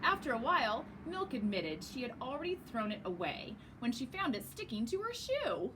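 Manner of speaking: ordinary delivery, nothing marked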